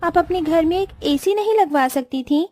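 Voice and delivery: sulky, pampered daughter-in-law griping about the heat; haughty young bride fussing; spoiled rich bride. Acted. Vedika voiced by narrator.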